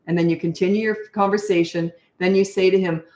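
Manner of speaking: usual speaking voice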